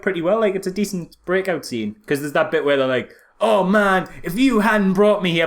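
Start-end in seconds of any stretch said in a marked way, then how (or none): 3.4-5.5 lightly hammy staged quarrel, a bit higher; hammed-up fake argument. Prisoners staging a fight for the guards, lightly hammed. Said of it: "really hamming it up".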